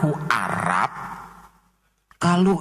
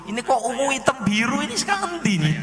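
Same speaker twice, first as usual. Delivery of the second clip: rising to a much higher exclaim; mock puzzlement; 9 semitones higher, faster than usual. The speaker puzzling over the wrong gene colours, mock baffled. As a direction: act baffled, voice climbing high.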